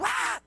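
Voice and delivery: sudden high startled shriek; high scream. Retold or imitated. Old woman left in the dark, screaming high. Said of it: "la escuchamos gritando".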